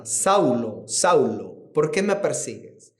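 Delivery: deep, grave divine voice calling Saulo; voice of God. Acted. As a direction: let it fall deep and grave like God speaking from heaven. God's voice calling Saul.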